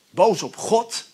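ordinary talk, nothing marked

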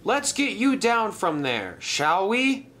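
high, encouraging call; cheerful calling. Acted. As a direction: coax a friend cheerily from afar. Stanley calls up to stranded friend.

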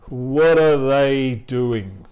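slow, loud "what are they doing" with "what are" blended as "whadda"; reduced ARE, drawn out. Shown as a demonstration. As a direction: stretch "what are they doing" slowly, keeping ARE a schwa. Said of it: "el A suena más como un A". ("what are they doing" lento y fuerte, con "are" reducido)

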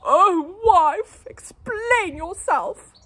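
high, loud, slow demand; demanding husband. A narrator's reading. Husband demanding his wife explain, loud. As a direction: demand an answer, drawn out. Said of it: "spluttered Mister Larrabee".

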